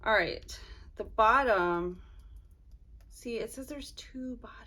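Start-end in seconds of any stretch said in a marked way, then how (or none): 3.1-4.6 whispered aside, checking the list; murmured whisper while counting. Real, not acted. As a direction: breathe it to yourself. Checking packing list quietly.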